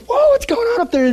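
high alarmed whoa; retelling their startled reaction, pitched high. Retold or imitated. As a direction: sound caught off guard and worried. Startled reaction in retold story.

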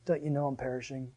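soft, low, desperate plea. Retold or imitated. Believer crying out to Jesus in a storm, soft plea. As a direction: murmur it up at the sky. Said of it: "I look up and I'm like".

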